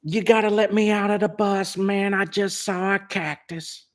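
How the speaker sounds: high, excited dude voice; Greg impression. Greg begging the bus driver to stop, high excited dude voice. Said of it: "He goes".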